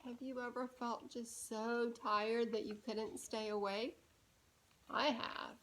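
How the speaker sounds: quiet, sleepy-sounding question; soft aside. Shown as a demonstration. Reader asking the child about being tired. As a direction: ask gently, like at bedtime.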